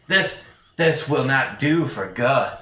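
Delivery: lightly voiced creature passing judgment; Staravash's verdict. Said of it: "Staravash ... is like".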